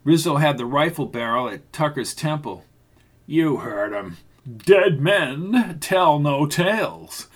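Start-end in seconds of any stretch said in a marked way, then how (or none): 3.3-7.3 slow gloating threat; menacing villain. Acted. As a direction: savor the threat slowly.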